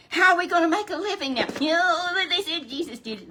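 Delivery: high, loud puppet voice. Acted. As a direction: puppet squawks the herdsmen's complaint.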